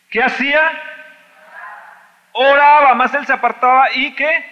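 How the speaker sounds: high, loud exultant cry; loud preacher declaring he prayed; preaching shout. Acted. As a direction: shout the answer to the crowd. Shouted answer Oraba.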